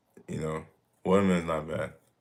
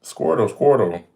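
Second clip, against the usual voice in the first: Squirtle; 5 semitones higher, 4 dB louder than usual. Pretend. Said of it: "I'll be out here like Squirtle".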